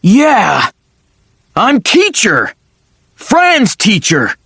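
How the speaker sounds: high, forceful angry shout. Acted. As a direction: bark it back indignantly. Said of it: "He sounded angry".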